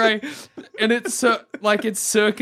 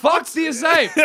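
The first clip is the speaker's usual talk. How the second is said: higher, louder jeer at a caged ape; mock yell at ape; 8 semitones higher, 4 dB louder, slower than usual. Friend acting out yelling at a zoo ape, mock angry. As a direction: snarl it at the ape through the glass.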